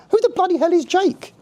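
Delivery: high, far-off voiced angel muttering her aside; an angel asking her colleague, wildly high and hushed; whispered aside. A narrator's reading. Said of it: "Clara whispered to her angel friend".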